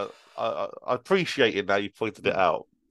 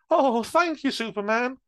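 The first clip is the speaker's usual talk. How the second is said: put-on higher, thin old lady voice; frail old lady; 14 semitones higher than usual. Old lady thanking Superman, high frail voice. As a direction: play a grateful frail granny. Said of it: "said the frail old lady".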